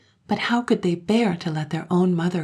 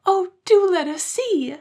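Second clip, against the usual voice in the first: put-on entreating children; 7 dB louder than usual. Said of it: "they entreated".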